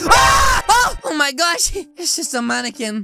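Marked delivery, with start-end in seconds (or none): 0.1-0.6 loud, high yelp of fright; startled scream. Acted. Player startled by a mannequin, frightened scream. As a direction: scream as if jumped by something. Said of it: "That really scared me".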